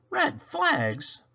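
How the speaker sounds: high puzzled little engine; muttered. Acted. Toodle sees red flags everywhere, high muttering. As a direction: grumble it high in disbelief. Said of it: "muttered Toodle".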